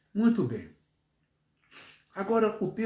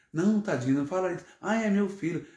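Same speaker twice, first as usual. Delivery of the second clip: mãe superprotetora imitada; 5 semitones higher, faster than usual. Retold or imitated. Mãe defende o filho mimado.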